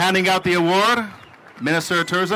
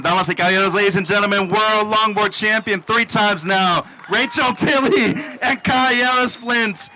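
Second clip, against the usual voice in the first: ring announcer; 4 semitones higher than usual. Acted. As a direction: stretch the champion's name like a ring announcer. Announcing both champions to the crowd.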